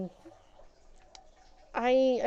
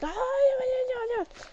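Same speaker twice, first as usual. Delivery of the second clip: much higher, brushing-off voice; mocking the thief; 13 semitones higher than usual. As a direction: mimic her sneering dismissal. Thief's dismissive reply mocked.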